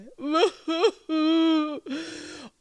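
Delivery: high, loud hammed-up wail; overly dramatic sob. Shown as a demonstration. Teacher demonstrates an overly dramatic cry for her students. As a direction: fake a huge theatrical sob. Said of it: "overly dramatic, sobbing cry".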